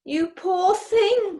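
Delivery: high, slow cooing over a sick pet; tender pity. Acted. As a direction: pour pity on the poor creature. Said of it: "cried Heki".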